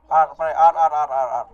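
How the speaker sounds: loud, repeated rapid-fire command; urgent callout. Real, not acted. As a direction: shout the call to hurry the team. Rushing team toward R in game. (pasigaw na utos, nagmamadali)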